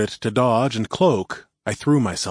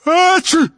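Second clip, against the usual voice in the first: big loud high sneeze; 9 dB louder than usual. Acted. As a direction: let out a full sneeze. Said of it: "I started to sneeze".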